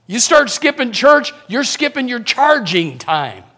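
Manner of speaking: usual speaking voice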